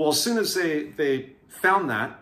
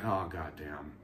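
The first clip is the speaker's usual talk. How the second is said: muttered dismay; 11 semitones lower, 13 dB quieter than usual. Retold or imitated. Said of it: "I'm like".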